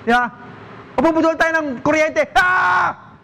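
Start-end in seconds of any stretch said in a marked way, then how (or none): 2.3-3.1 high startled shout of alarm. Shown as a demonstration. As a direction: yelp as if suddenly shocked. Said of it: "You're reacting while you're stressed".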